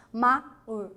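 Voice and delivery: "Ma, u" in two detached pieces; wrongly split word. Shown as a demonstration. Teacher repeats the split nobody uses, broken in two. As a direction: separate "Ma" from a short "u". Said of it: "ninguém fala ma".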